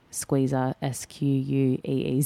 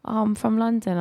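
higher-pitched imitation Londoner; put-on London accent; 7 semitones higher than usual. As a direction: answer as a Londoner. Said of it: "they'll be like".

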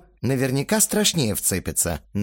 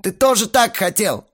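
high, loud exasperated explanation; heated yelling; 11 semitones higher, 5 dB louder than usual. A narrator's reading. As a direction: argue back at full heat. Belfigor keeps yelling at Astarot. (раздражённо доказывает, на повышенных тонах)

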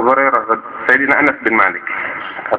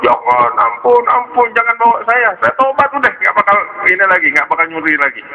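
tearful begging; 11 semitones higher than usual. Acted. Caught boy begs not to be taken away, acted crying. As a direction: plead like a crying child in a hammed-up whine. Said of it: "Ini bocah dia nangis".

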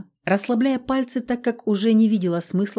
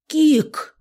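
indignant cry; 11 semitones higher, 5 dB louder than usual. A narrator's reading. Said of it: "возмущённо взглянула на него".

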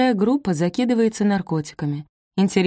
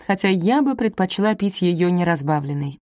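usual speaking voice

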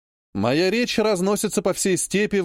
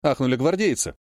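plain reading voice

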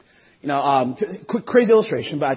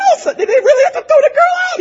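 much higher, fast, hammed-up blubbering; mock crying at a movie; 5 dB louder, faster than usual. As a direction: wail at the screen like a weepy moviegoer. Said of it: "I cry".

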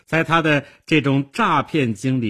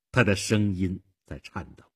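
ordinary delivery

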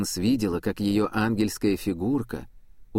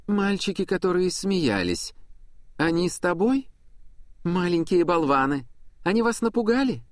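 lightly voiced old woman; 7 semitones higher than usual. Prudence asks about the boys.

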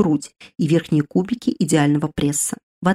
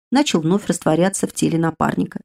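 usual speaking voice

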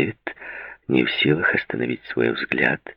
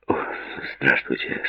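mildly embarrassed, softer greeting; 7 dB quieter than usual. A narrator's reading. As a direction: greet softly, a bit flustered. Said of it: "смущённо произнёс Купер".

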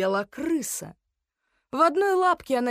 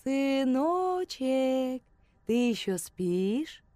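tender call; 4 semitones higher, much slower than usual. A narrator's reading. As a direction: wake a child gently. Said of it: "ласково позвал".

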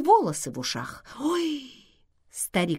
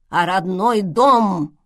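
loud, slow shout; 8 dB louder, slower than usual. A narrator's reading. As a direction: shout it out, slowly. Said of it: "крикнул старик".

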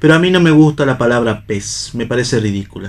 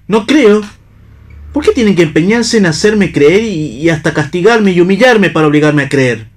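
loud, high indignant outburst; angry protest; 6 semitones higher, 4 dB louder than usual. Acted. Pablo protesting to the two women that they force belief, furious. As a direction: hurl the question back at them.

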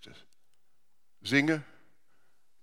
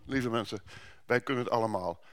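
ordinary delivery

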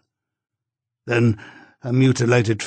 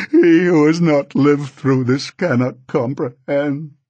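higher and louder, slowing in grief; anguished confession; 4 semitones higher, 4 dB louder than usual. A narrator's reading. Husband confessing the killing, rising anguish. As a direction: build to a grieving outcry.

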